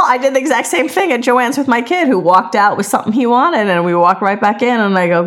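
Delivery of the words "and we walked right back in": usual speaking voice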